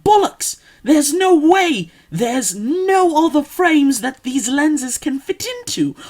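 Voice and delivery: loud, high British outburst, drawn out; indignant protest. Retold or imitated. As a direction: protest loudly at the optician, stretching the words. Retold argument with the optician.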